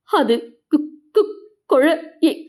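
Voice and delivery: broken, stammering starts, pitch a little up; faltering stammer. A narrator's reading. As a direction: she can't get the words out. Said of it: "என மீனா தடுமாறி சொல்லிட". (தடுமாறிய திக்கல் குரல்)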